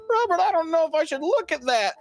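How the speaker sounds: lightly voiced nervous kid; high child voice. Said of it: "dad reading paper, boy voice".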